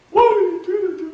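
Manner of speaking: high, loud sobbing plea, voice far above his own; anguished cry. Retold or imitated. Retelling the client's cry under hypnosis, a high loud anguished plea. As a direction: cry out the question as if the pain is fresh. Hypnotized woman cries over abuse.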